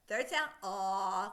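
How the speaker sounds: slow, drawn ah sound. Shown as a demonstration. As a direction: stretch the ah like a sound-out. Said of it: "let's sound it out".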